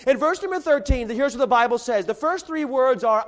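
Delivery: usual speaking voice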